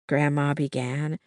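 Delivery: plain reading voice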